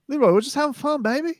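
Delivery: high-pitched playful wheedle; playful coaxing. Real, not acted. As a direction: coax a grumpy co-host in a high voice. Playfully coaxing his grumpy co-host.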